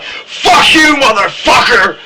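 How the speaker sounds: sharp high yell at someone; angry scream. Shown as a demonstration. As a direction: yell it like you're cursing someone out. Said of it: "You know when you scream at people like".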